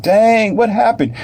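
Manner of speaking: high, stunned exclamation. Retold or imitated. Onlooker after the knockout, high stunned voice. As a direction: gasp it out in disbelief. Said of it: "You be like".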